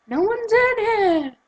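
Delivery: drawn-out, high-pitched call; high mock call. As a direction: call out high and slow in pretend innocence. Imagined calling from hiding.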